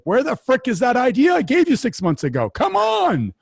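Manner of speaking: high, exasperated demand, pitch jumping way up; mock-frustrated boss pushing for his idea; impatient visionary. Visionary boss demanding his forgotten idea from the team, mock-exasperated and high. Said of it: "the visionary is like".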